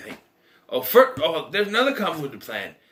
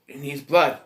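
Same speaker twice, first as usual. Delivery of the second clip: usual speaking voice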